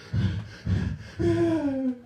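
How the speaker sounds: long high-pitched whine; mock sob. Arrested man shown another tattoo, high mock sob. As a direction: draw out a comic wail. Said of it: "And boy, was I a crier".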